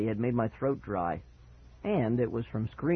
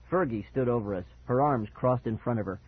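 ordinary delivery

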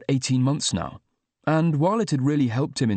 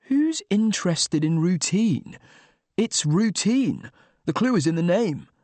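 incredulous; 6 semitones higher than usual. A narrator's reading. Narrator's defensive inner retort.

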